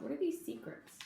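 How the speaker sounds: soft whispered wonder; whispered question. Real, not acted. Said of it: "asks children in hushed voice".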